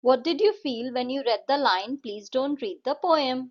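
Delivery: slow, clear question reading; slow textbook read-out; reading a question aloud. Acted. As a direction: read it slowly so the class can follow. Reads question slowly.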